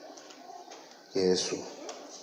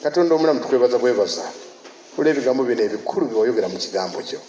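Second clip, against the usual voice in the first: prayer; 3 semitones lower than usual. Real, not acted. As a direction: lead the church in earnest prayer. Leads closing prayer.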